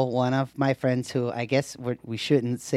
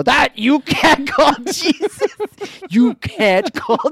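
put-on high, loud, slow child voice, laughing in protest; imitating little daughter; 12 semitones higher, 6 dB louder, slower than usual. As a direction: plead like an exasperated little girl. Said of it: "And she'll go".

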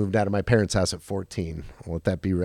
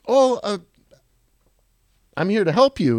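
flustered offer; 9 semitones higher, 3 dB louder than usual. Retold or imitated. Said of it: "I'm like".

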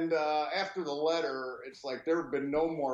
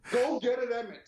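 laughing; 7 semitones higher, 4 dB louder than usual. Retold or imitated. Laughing, urging dog on.

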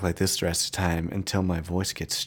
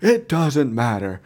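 a light take on a wrestler's catchphrase, slow and a bit higher; The Rock's catchphrase; 4 semitones higher, slower than usual. Retold or imitated. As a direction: drawl it out like The Rock. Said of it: "he took his catchphrase".

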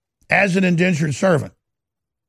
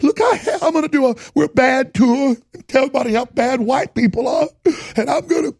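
higher, sing-song mock of the politician; lightly camped-up parody of a gleeful hypocrite; relishing mockery; 7 semitones higher than usual. Host parodies the politician's glee in a higher sing-song mock voice. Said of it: "how he's relishing".

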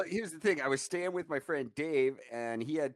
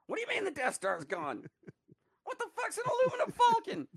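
much higher, laughing mock outrage; acting out the scene. Reenacting the funniest scene for a friend, laughing high squeal. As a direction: squeal the line through laughter.

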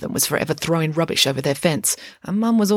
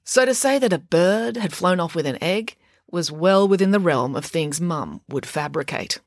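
usual speaking voice

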